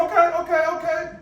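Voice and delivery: high, loud mock surrender; yielding to a stubborn father, high and loud; backing off. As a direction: throw your hands up, going high. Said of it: "I said".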